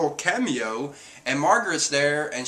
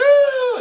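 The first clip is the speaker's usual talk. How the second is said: loud, very high squeal; hammed-up high-pitched scream; imitated scream; 9 dB louder than usual. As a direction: squeal out a mock shriek. Imitating Chopper's startled scream.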